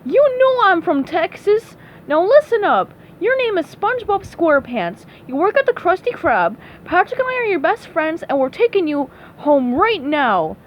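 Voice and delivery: high, exasperated character voice; frustrated. Acted. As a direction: lay down the facts, fed up. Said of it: "Sandy was getting frustrated".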